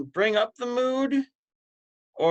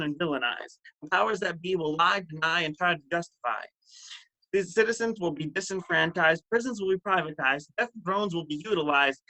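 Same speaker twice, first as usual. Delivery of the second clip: rhythmic, incantatory poetry delivery; driving recital, leaning on each repeated phrase; spoken-word poem. Acted. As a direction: perform it as a slam poem with swinging pitch. Performs poem at open mic.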